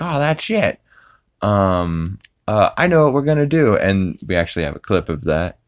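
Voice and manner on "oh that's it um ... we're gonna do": Peter voice. Retold or imitated. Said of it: "Peter's like".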